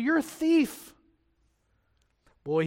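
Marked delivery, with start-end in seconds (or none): 0.0-0.9 high-pitched mock accusation; accusing. Servant calling his master a thief, accusing. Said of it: "he's essentially accusing him of being a thief".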